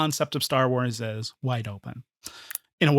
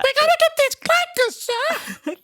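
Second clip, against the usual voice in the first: much higher, louder silly soldier voice; clone trooper impression; 3 dB louder than usual. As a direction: ham up a clone reporting to his officer. Imitating clone trooper line.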